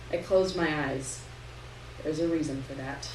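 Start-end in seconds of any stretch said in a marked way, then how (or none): none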